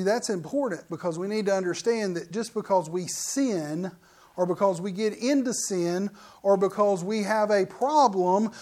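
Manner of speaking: ordinary delivery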